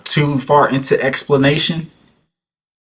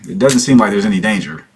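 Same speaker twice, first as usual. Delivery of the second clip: ordinary talk, nothing marked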